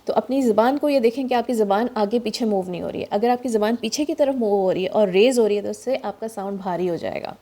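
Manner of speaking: ordinary delivery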